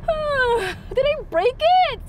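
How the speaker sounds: high, worried mock fright; high pitched mock worry; worried squeak. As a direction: squeak it like you broke something. Retelling fear of breaking pump.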